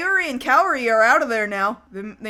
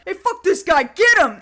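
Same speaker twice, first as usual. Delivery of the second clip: raised-pitch, rowdy goon order; mock thug aggression; 6 semitones higher, 4 dB louder than usual. Thugs egging each other on at Ryo, mock aggressive. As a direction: imitate a thug rallying the others.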